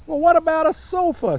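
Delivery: high, bright frog question; hopeful frog. A narrator's reading. The frog hoping for a sofa, high and eager. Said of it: "said the frog".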